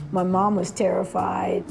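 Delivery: usual speaking voice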